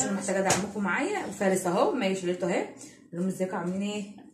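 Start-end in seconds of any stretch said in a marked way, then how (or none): none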